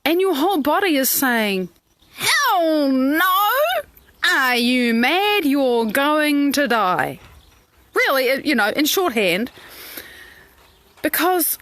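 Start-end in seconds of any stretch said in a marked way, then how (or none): 2.1-7.2 slow, drawn-out incredulous protest; mock-alarmed refusal. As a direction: stretch each word in hammed-up disbelief. Voicing the body's alarmed refusal.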